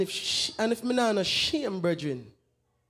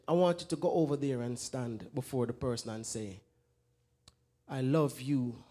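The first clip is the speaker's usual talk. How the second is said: soft tender plea; 7 semitones lower, 5 dB quieter than usual. Retold or imitated. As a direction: lower the voice and lay each line down softly. Preacher retells his tender instruction.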